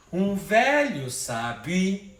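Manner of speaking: very drawn-out, beat by beat; slow syllable scansion. Shown as a demonstration. Teacher slowing the verse to show the syllable merge. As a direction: pull each syllable apart slowly. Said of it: "aqui já foi pro outro canto".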